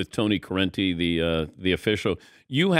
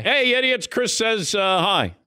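much higher, bright call to the crew; playful greeting; 9 semitones higher than usual. Pretend. Host passing Chris's hello to crew, high playful call. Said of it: "tell those idiots I said hi".

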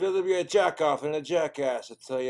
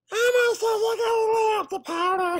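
squeaky high-pitched puppet voice, shouted; a loud shrill Elmo impression; bad Elmo voice; 7 dB louder than usual. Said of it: "one bad voice impression".

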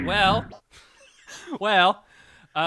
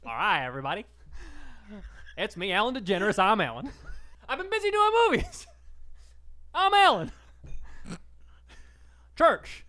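bad, higher Ellen at church; Ellen impression; 5 semitones higher, faster than usual. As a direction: Ellen greeting a church crowd. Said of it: "Ellen at church".

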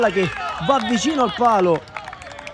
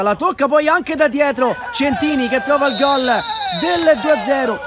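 rising goal call, slower and louder; 7 semitones higher, 5 dB louder, slower than usual. Real, not acted. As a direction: call the goal with a slow build. Goal scored, two-nil.

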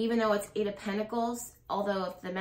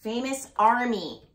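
loud disbelief building to a yell; stunned outburst; 5 semitones higher, 12 dB louder, slower than usual. Real, not acted. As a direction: exclaim it, then yell higher and slower, vindicated. Stunned that her reading is confirmed.

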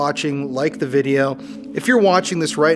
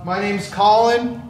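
higher, slow and deliberate; formal self-introduction; 8 semitones higher, 3 dB louder, slower than usual. Acted. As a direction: introduce yourself to the unseen. Introducing himself to spirits.

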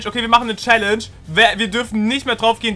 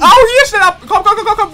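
high, loud frantic calls; urgent shouting; 11 semitones higher, 7 dB louder than usual. Real, not acted. Gamer frantically calls his friend away from the Warden. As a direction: hurry him along yelling.

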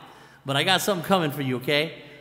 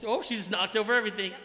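ordinary talk

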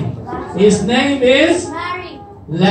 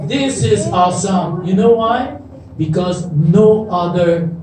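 ordinary talk

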